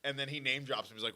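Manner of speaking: ordinary talk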